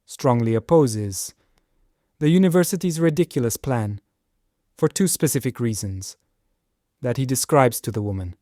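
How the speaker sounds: broken into short chunks, each ending in a fall; choppy with many pauses. Shown as a demonstration. Teacher demos a disfluent reading full of pauses. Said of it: "the clunky transition phrase".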